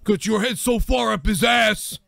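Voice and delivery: slow high mock tirade; mock Bret Hart shout. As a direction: bellow it like an outraged wrestler. Mocking Bret Hart rant.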